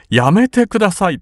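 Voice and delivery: high imploring plea; begging. A narrator's reading. The sister chases her brother and begs him to stop. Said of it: "と哀願した".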